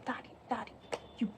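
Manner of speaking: Daddy, Daddy, you bastard breathed out in a whisper. Shown as a demonstration. Teacher whispers the poem's refrain softly. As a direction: whisper it like a secret curse. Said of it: "Again, the repetition".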